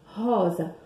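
"rosa" with a raspy throat R at the start; guttural R demo. Shown as a demonstration. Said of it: "arranhando assim a nossa garganta". ("rosa" com o R arranhando a garganta)

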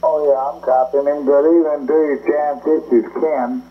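normal talking voice

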